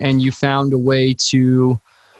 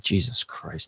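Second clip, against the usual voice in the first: much lower, much softer groaned aside; mortified mutter; 9 semitones lower, 11 dB quieter, slower than usual. Retold or imitated. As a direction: say it under your breath, cringing. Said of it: "And I'm like".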